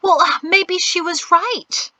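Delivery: high, slow sulky pout. A narrator's reading. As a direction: sulk it out. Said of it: "Priscille pouted".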